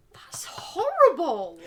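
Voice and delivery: slow, whispered horror; whispered disgust. Real, not acted. Whispering in disgust at his joke. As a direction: breathe it out, appalled.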